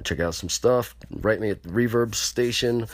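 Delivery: ordinary talk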